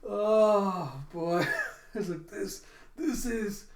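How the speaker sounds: high, breathless words through leftover laughter; trying to talk while still giggling; still laughing. Real, not acted. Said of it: "that's why I laughed too hard".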